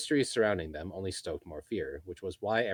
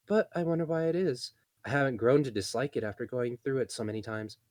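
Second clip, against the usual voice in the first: higher, weary chatty elf; tired elf woman; 7 semitones higher, 4 dB louder than usual. Acted. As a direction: sigh out the tiredness. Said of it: "completely exhausted".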